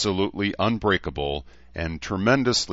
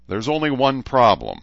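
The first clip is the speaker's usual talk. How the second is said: plain reading voice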